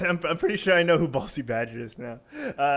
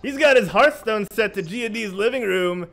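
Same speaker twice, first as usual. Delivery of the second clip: big booming host intro; announcer hype; 5 semitones higher, 5 dB louder, slower than usual. Acted. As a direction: hype up the guest like a wrestling announcer. Hyped guest introduction.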